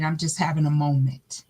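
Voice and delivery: ordinary talk, nothing marked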